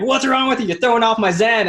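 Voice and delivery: high, loud mock snap at his son; irritated outburst. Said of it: "and, like, upset at him".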